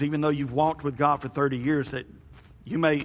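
normal talking voice

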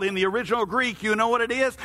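ordinary talk, nothing marked

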